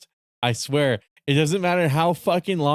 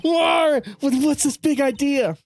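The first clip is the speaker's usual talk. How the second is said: anime protagonist shout; 10 semitones higher than usual. Acted.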